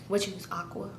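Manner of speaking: soft, low seatmate murmur; imitated funeral undertone; retold hushed question. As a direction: ask quietly, as if mid-service. Chatty seatmate during the funeral.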